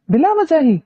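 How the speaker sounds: high, peeved question; annoyed. A narrator's reading. Girl annoyed at his monkey-face claim, peeved. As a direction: toss it back, irritated. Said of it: "वो खफकी से बोली".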